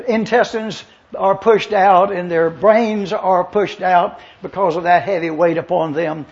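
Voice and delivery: ordinary talk, nothing marked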